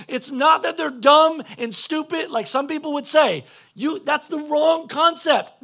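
loud, high, emphatic correction; full-force preaching. Acted. Preacher correcting how people judge the lost, full-force preaching. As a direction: preach it forcefully, drawing out the key words.

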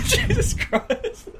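bursting out laughing; words squeezed out through laughter. Real, not acted. Cracks up at a crude joke.

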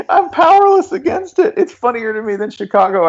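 high-pitched, voice squeezed by laughter; helpless laughter. Real, not acted. Host cracked up by a co-host's Pittsburgh accent, laughing helplessly. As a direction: talk through the tail of a laughing fit.

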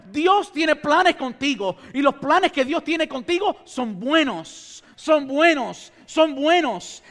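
loud, fervent sermon delivery; preaching. Acted. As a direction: preach it with rising fire. Preaches at full force.

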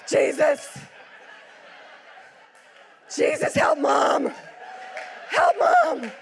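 put-on little boys shouting a revival prayer; Lou Engle. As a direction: cry out to Jesus like a fiery intercessor. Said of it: "They just turn into Lou Engle".

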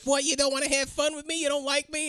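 high, put-on come-on voice; prostitute. Prostitute propositioning the man in the car, high wheedling imitation. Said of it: "the prostitute's like".

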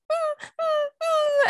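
very high, slow whimpering puppy cry; imitated puppy whine. Owner imitating her puppy whining when she leaves, very high and slow. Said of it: "he's like".

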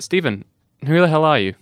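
ordinary talk, nothing marked

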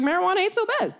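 high-pitched mock-innocent excuse; hopeful excuse. Cheeky plea to parents.

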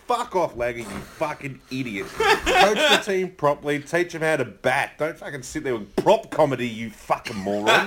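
loud, scornful tirade; mock-furious rant at the coach; angry rant. Real, not acted. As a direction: lay into him, half laughing. Ranting at Langer's stunt.

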